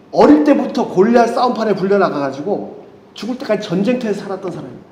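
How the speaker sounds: ordinary talk, nothing marked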